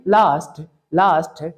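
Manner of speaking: लास्ट said low with a clean English st; corrected last. Shown as a demonstration. Teacher repeats the corrected लास्ट for students. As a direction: repeat लास्ट with the foreign st sound. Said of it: "ट नहीं, लास्ट नहीं".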